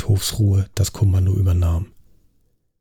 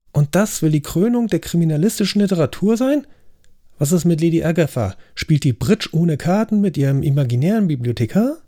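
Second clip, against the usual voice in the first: höher, empört sich aufregend; empörter Ausbruch; 7 semitones higher than usual. Acted. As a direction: genervt über die Gäste schimpfen. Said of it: "Ich knallte dröhnend mein Besteck auf den Tisch". (Gastgeber schimpft empört über die Autoren, höher)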